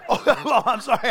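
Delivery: usual speaking voice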